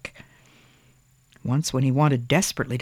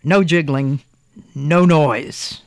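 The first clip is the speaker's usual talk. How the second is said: firm, loud commanding voice; stern order; 6 dB louder, slower than usual. A narrator's reading. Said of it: "he ordered Thomas".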